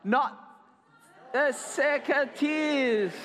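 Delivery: high, drawn-out wail of protest; demonstrated cry. Shown as a demonstration. Storyteller shows children what to shout, crying out. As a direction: cry it out slowly, hamming it up. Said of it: "I wanted you to cry".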